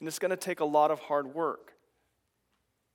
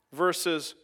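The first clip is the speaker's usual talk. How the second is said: ordinary talk, nothing marked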